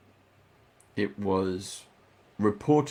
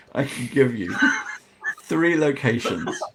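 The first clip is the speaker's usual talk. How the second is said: real laughter breaking through the line; 3 semitones higher, 4 dB louder than usual. Real, not acted. Game master thrown by Fizz's antics, laughing. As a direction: try to carry on while laughing. Cracks up at what Fizz did.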